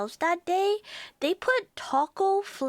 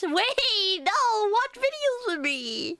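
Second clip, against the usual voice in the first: playful whine; 7 semitones higher, slower than usual. Real, not acted. Pleading playfully with viewer.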